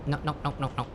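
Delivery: high panicked pleading; frantic nos. Real, not acted. Panicked at crash landing.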